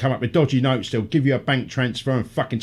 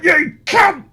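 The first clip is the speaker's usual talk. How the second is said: angry shout; 6 dB louder than usual. Retold or imitated. Angry voice tag to timewaster.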